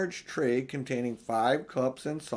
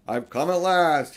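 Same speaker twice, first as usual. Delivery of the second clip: Father Christmas; 4 semitones higher, 7 dB louder than usual. Acted. Father Christmas arrives, grand greeting.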